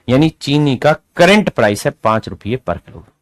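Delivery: ordinary talk, nothing marked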